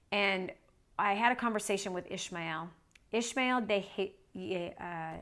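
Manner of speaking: normal talking voice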